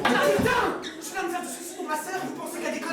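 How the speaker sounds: high-pitched angry protest, exploding at the start; exasperated outburst. Acted. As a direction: blow up at the joking friends. Brother loses patience with the jokes. (emportement exaspéré, voix aiguë)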